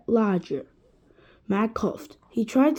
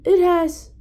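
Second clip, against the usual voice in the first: higher, louder cut-off shout; 6 semitones higher, 8 dB louder than usual. A narrator's reading. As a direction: shout it out to the class. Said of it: "shouted Eric Bacon".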